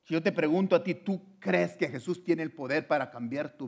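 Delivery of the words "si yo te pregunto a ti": ordinary talk, nothing marked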